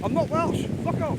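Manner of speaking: high, loud snapped retort; mock indignant shout. Answering a bleating sheep, loud mock outrage. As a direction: pretend to snap back at the sheep.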